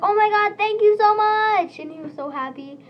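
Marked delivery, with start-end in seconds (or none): 0.0-1.8 loud, high, gushing thank-you; a friend overjoyed at the drawing, squealing his thanks; excited thanks. Retold or imitated. Friend thanking Ella for drawing Nyan Kitty, loud and thrilled. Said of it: "and then he was like".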